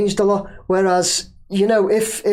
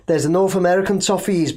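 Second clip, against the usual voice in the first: usual speaking voice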